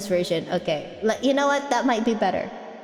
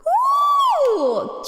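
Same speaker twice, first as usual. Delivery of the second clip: high loud whoop; cheering; 3 dB louder than usual. Real, not acted. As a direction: whoop with delight. Cheering at the song.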